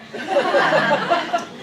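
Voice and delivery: bright burst of laughter; loud laugh. Real, not acted. Laughs after punchline.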